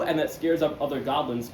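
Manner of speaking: usual speaking voice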